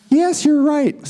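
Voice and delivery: high feminine twang; high-voiced puppet-show doll with a twang; Barbie's feminine twang. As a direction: pitch it up into a girly doll voice. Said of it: "In her feminine twang".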